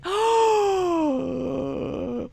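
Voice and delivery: long, drawn-out gasp; shocked gasp. Real, not acted. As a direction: gasp at length in outrage. Shocked at separate price.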